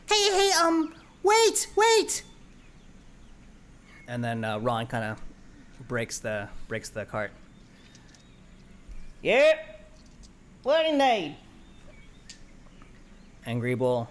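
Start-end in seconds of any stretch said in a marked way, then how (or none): 0.1-2.2 very high, loud plea; Greeble calling out. Acted. Greeble calls after the cart, high and loud. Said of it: "Greeble ... goes". 9.2-11.4 very high, loud cheerful voice; chirpy oaf answer; Ron. Acted. Ron answers Greeble, high and loud.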